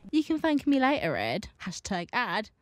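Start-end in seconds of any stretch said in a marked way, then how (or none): none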